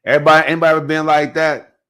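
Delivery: high, loud, fast question to the crowd; rally the room, pumped up; excited callout. Real, not acted. Calling out to the audience.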